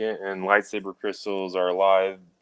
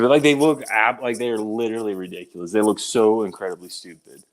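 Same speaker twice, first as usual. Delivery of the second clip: ordinary talk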